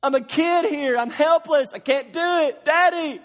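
high, loud crying plea; shouted cry for help; desperate plea. Retold or imitated. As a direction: beg like a helpless kid. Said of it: "When your marriage is on the rocks".

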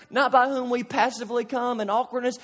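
usual speaking voice

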